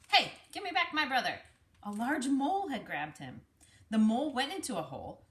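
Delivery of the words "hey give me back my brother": high, loud indignant shout; indignant call. Acted. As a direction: demand him back. Chasing mole who took brother.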